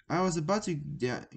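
ordinary delivery, nothing marked

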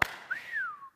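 high delighted giggle; amazed laugh. Retold or imitated. Preacher as awestruck Isaiah, giggling in wonder. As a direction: laugh out in astonishment. Imagined awe at God.

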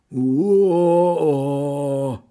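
long, high drawn-out wail; mock wailing moan, held long. A narrator's reading. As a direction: howl a sustained "oh". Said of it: "heulte Hans".

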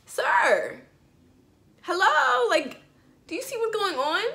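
sir, can't you see what's going on; incredulous shout at the cashier; exasperated outburst. Retold or imitated.

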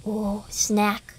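ordinary talk, nothing marked

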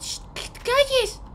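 high, slow, strained hush; urgent shushing. Pretend. Child hushes Vita who screams thief, high urgent hiss. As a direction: shush them desperately without being heard. Hushing friend who raises alarm.

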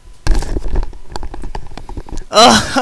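a loud laugh, mostly breath; loud unvoiced laugh. Real, not acted. Gamer laughing loudly at what just happened on screen. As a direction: let a big laugh burst out. Big laugh after long pause.

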